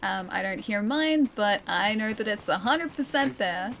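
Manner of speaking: usual speaking voice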